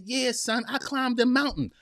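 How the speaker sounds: normal talking voice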